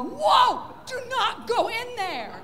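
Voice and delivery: loud high Jim Carrey yelp. Retold or imitated. Pug's breath summons a yelling Jim Carrey. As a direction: burst out like a rubber-faced Jim Carrey. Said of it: "Jim Carrey to pop out and go".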